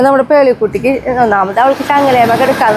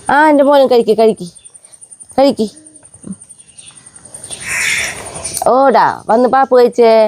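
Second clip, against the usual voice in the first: coaxing pet talk; 3 semitones higher than usual. Acted. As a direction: coax each pup to eat. Coaxing puppies to eat.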